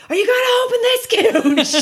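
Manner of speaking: high-pitched, hollered demand; re-enacted shout. Impatient customer to the woman behind the bar, shouting. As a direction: yell it across a bar in mock outrage. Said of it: "Shout to the woman behind the bar".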